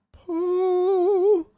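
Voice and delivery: high, drawn-out playful poll; sing-song poll. Pretend.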